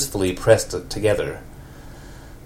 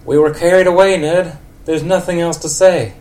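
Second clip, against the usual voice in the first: put-on high, loud old lady voice; 9 semitones higher, 6 dB louder than usual. Old lady Marge tells Ned there's nothing else to say. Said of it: "old lady for Marge".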